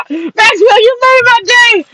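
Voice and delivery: can barely talk for laughing; real delighted laughter; laughing hard. Real, not acted. Cracking up at a troll's comment.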